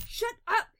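furious, high, explosive shout; sudden angry outburst; growled. A narrator's reading. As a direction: growl it out at full volume. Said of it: "he growls".

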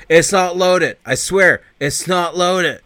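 swearing to mom it isn't loaded, panicked; panicked pleading. Retold or imitated. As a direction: insist desperately to the mom. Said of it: "Kane's like".

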